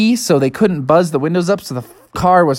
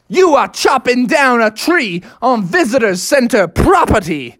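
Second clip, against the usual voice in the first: higher-pitched, emphatic cop voice; stern police officer; 4 semitones higher than usual. Acted. Officer accuses dad of chopping a tree, stern. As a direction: lay down the law word by word.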